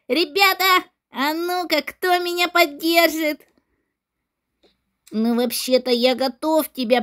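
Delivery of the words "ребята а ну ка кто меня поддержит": ordinary delivery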